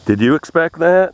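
high, loud excited question. Real, not acted. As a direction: call it out thrilled.

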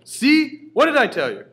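high loud gloating yell; father crowing over the dead cat; triumphant shout. Acted. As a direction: shout it like you proved your point.

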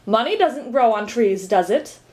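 plain reading voice

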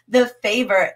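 ordinary talk

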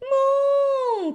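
high, loud excited shout; excited cry. Acted. Little George crying out excitedly. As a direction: George cries out as balloon goes. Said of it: "cries George".

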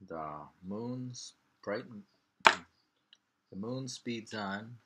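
slow, deliberate haiku reading; poem reading. Shown as a demonstration. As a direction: savor each phrase slowly.

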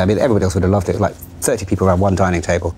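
normal talking voice

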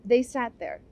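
ordinary delivery

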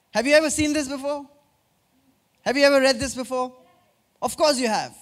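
normal talking voice

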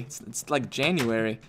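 ordinary talk

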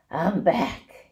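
a touch of husk, rat just back; lightly husky and tired; husky rat voice, understated. A narrator's reading. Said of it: "he said in a husky voice".

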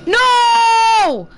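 high, loud no; real loud no; loud shout of despair. Real, not acted. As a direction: yell as he is caught. Caught by enemy.

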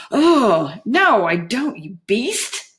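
high, loud, appalled exclamation; disgusted outrage. Acted. Juliet recoiling at the prisoner's dolphin taunt, disgusted. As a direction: swoop up high in horrified indignation.

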